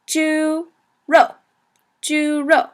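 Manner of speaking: "Juro" held high on Ju, dropping sharply on ro; first and fourth tone. Shown as a demonstration. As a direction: stretch "Juro" out, high level then falling. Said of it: "first tone and fourth tone".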